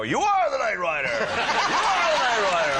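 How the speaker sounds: high, loud mock chant, slowed on the repeat; crew cheering. Crew greets him on set, loud chant. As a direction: chant it like a crowd greeting a star. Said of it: "they go".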